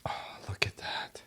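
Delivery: breathless little whisper of amazement; whispered awe. Real, not acted. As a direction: hush it like a secret over the pot. Marvels at tender meat.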